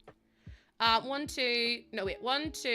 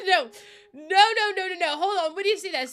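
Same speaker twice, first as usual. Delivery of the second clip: louder, higher rapid protests; panicked no's; 7 semitones higher, 8 dB louder than usual. Real, not acted. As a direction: panic as the build breaks. Panics as the game breaks.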